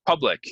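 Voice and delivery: "public" said PUH-blik; pronunciation demo. Shown as a demonstration. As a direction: open uh in the first syllable of "public". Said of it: "is how we pronounce this".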